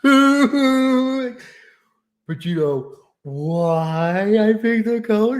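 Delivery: slow, teasing put-on voice of the wife; imitating the wife. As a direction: draw it out like a playful wife with a secret.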